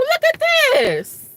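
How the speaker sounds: high, slow astonished; drawn out like you can't believe it; exasperated disbelief. Retold or imitated. Reacting to the news, high slow astonishment. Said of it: "I said".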